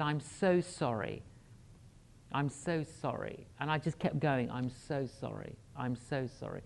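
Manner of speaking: soft repeated sorry, fading; quiet sincere apologies over and over; repeated gentle apology. Retold or imitated. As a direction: keep apologising softly.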